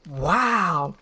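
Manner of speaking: plain reading voice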